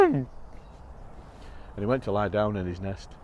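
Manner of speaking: ordinary delivery, nothing marked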